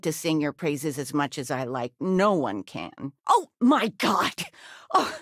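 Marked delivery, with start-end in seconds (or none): 3.3-5.2 startled cry. Acted. Mother startled by sudden appearance.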